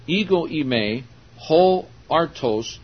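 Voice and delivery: "Ego eimi, ho artos" said slowly in Greek, each syllable spelled out; Greek pronounced. Shown as a demonstration. Preacher pronouncing the Greek for his listeners, slow and clear. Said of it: "So I'll pronounce that for you".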